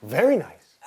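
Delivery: hammed-up relish, as if savouring a tincture; imitating teacher. Herbalist imitating his expressive teacher, lightly hammed relish. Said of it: "he'll be like".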